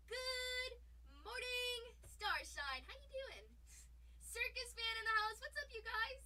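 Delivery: gleeful stream greeting; excited greeting. Real, not acted. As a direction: greet the room with a big bright call. Greeting viewers arriving on stream.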